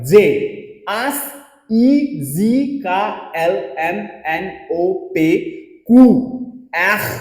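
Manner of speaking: आ बे से दे spelled out very slowly; repeat-after-me alphabet. Shown as a demonstration. Teacher leads students in repeating the French alphabet. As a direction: model each letter slowly for repetition. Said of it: "आप मेरे साथ repeat करो".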